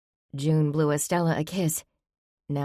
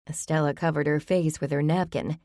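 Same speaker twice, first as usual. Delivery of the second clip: ordinary delivery, nothing marked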